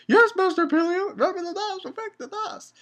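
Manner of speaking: very high put-on squeal, loud start; mimicked follower. Kid answering his pretend master, high mock eagerness. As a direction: squeak it out like an over-eager underling. Mimicking kids playing the Sins.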